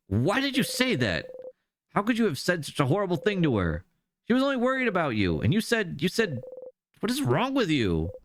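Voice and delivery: upset Isabeau. Acted. Isabeau scolding Siffrin for hurting Odile, shocked. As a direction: plead in disbelief.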